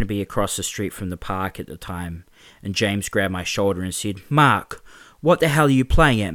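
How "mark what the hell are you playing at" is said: loud, higher, hard challenge; angry confrontation. Acted. James confronting Mark in the street, loud and angry. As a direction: grab him and demand an answer.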